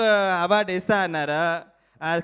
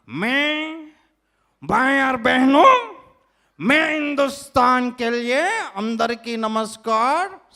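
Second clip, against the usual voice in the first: higher, slow, rally-style Hindi opening; Hindi politician speech; 5 semitones higher, 3 dB louder, slower than usual. Acted. Mimic opens a rally speech as a Hindi politician. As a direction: address brothers and sisters like a rally speaker.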